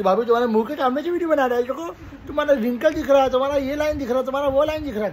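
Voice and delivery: high, teasing imitation of a relative needling the sister-in-law; mimicking her teasing. Vlogger mimics a relative teasing Asifa, high mocking voice. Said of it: "बोलती".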